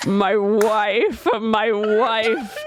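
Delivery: low, slow, swooning husband impression; drunk adoring papa. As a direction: croon it like a besotted drunk. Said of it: "And Papa starts going".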